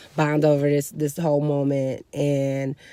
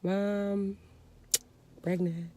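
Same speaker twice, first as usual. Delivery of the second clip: barely audible confession, imitated; super whisper; 4 semitones higher, 12 dB quieter, much slower than usual. Daughter confessing from the corner to mom, super whisper. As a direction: whisper it very softly. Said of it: "I'm whispering, like, just super whispering".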